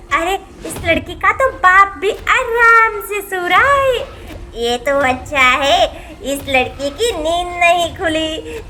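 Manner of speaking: sly witch gloating, lightly voiced; a hint of a scheming witch. Witch pleased nobody woke, sly and gloating. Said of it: "इस चुड़ैल".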